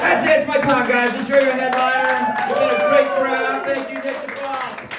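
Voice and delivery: loud, high closing to the crowd; stage sign-off. Real, not acted. Comic signing off to the crowd, loud and high. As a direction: wrap it up big for the room.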